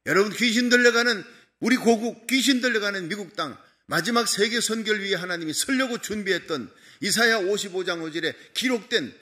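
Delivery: loud, rising pulpit climax; loud impassioned sermon peak; preaching at full force. Acted. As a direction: drive it home to the congregation.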